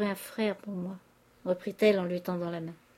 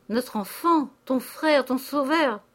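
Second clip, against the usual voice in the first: louder, higher fatherly plea; crying out; 5 semitones higher, 5 dB louder, slower than usual. A narrator's reading. Old father pleading with his daughter for his son, crying out. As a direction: cry out each phrase in urgent appeal. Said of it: "criait le père Goriot".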